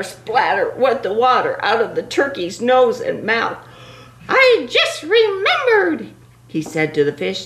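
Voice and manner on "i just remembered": panicky turkey voice. Acted. Turkey character speaking.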